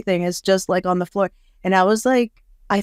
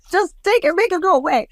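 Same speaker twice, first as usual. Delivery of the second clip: high, desperate mock plea; pleading client; 12 semitones higher than usual. Clients begging the practitioner, high mock plea. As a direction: play the client begging to make it stop. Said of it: "come in and just be like".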